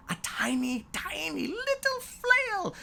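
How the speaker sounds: rising squeaky tiny. A narrator's reading. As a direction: voice climbs high on tiny little flail.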